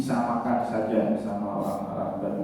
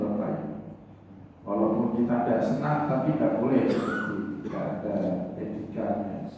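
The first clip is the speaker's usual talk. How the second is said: ordinary talk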